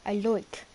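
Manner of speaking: "like" said as loik, Irish style; Irish I sound. Shown as a demonstration. Explaining Irish accent to viewers, like as loik. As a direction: round the vowel of "like" into loik. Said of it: "the I's for the Irish is like a U".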